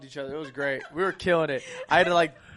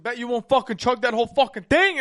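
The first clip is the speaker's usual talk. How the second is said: raised, loud mock goading; taunting dare; 5 semitones higher, 8 dB louder than usual. Friends daring the drinker to chug, loud taunt. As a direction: egg them on loudly, imitating rowdy friends.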